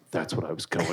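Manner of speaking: very low, soft mumble; deep, hushed actor impression; Tom Hardy. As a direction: drop right down into a muttered character voice. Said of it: "I feel like if he heard you say that".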